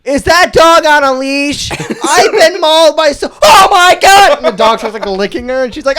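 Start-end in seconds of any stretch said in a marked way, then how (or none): 0.0-4.4 high, loud panicked holler, building to a shriek; drawn-out, hammed-up yelling; mock screaming. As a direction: imitate her overblown alarm at a dog. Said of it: "Yeah, she's screaming".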